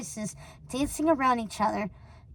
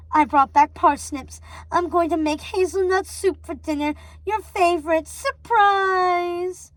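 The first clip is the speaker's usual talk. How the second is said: high, slow stagey voice; animated; 5 semitones higher, 4 dB louder than usual. A narrator's reading. Gothel to Rapunzel, overly animated mother. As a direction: ham it up like a stage actor. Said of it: "acting very animated".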